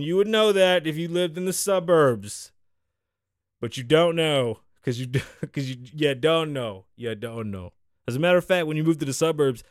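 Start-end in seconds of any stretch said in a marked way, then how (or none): 5.9-7.7 lightly put-on Jamaican accent; a hint of jokey Jamaican patois. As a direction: slip into a mock Jamaican accent. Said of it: "you get a Jamaican accent".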